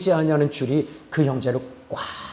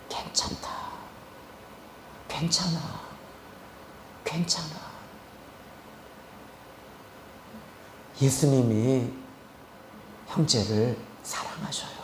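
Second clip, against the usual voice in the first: soft, hushed comfort with long pauses; gentle, low-key consoling; tender reassurance; 3 semitones lower, 11 dB quieter than usual. Retold or imitated. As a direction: soothe someone in your arms, very quietly. Comforting the embraced suicidal man.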